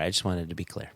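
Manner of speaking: normal talking voice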